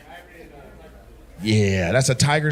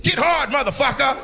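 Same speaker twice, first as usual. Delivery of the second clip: mock yell; 9 semitones higher than usual. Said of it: "She just yell at your dick".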